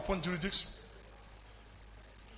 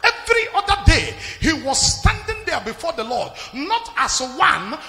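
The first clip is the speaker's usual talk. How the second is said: raised pitch, long stretched emphatic words; rousing preaching; 5 semitones higher, 7 dB louder than usual. Real, not acted. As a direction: build the sermon up in bursts. Sermon builds up again.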